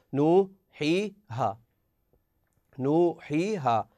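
نوحيها said very slowly with each long vowel held; drawn-out reading. Shown as a demonstration. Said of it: "واو ساكنة مضموم ما قبلها". (نوحيها ببطء شديد مع مدّ كل حرف مدّ)

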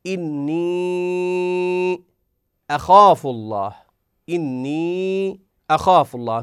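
إني drawn very long before أخاف الله; Quranic recitation. Shown as a demonstration. Teacher reciting the verse to show separated madd, chanted. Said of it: "نقول".

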